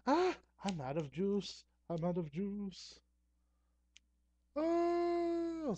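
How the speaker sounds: high-pitched wail of dismay; mock alarm. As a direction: cry out in high, drawn-out dismay. Camera light runs out of juice.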